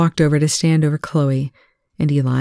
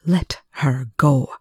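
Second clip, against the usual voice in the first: slow alien hiss; slower than usual. Acted. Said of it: "It hissed at him".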